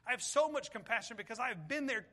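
ordinary delivery

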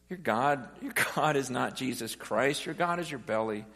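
ordinary talk, nothing marked

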